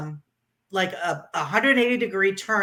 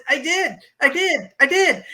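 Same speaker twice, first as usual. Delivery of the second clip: much higher and louder, "I did" repeated in joy; bursting with pride at the finish line; elated disbelief; 8 semitones higher, 4 dB louder than usual. Real, not acted. Finishing the ride, crying and laughing.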